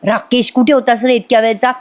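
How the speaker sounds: ordinary delivery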